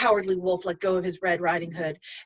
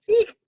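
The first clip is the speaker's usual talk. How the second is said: high, louder eek of alarm; surprised squeak; 13 semitones higher, 7 dB louder than usual. Real, not acted. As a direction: squeak at your own mistake. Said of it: "oh, I skipped part of it".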